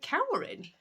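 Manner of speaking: high, questioning echo; puzzled nurse. Retold or imitated. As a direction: echo the word as if it makes no sense. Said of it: "And she went".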